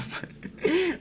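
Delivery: airy high-pitched giggle; high breathy laugh. Real, not acted. Asked about his accent.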